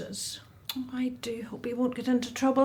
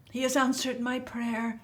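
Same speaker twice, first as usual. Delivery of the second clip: ordinary talk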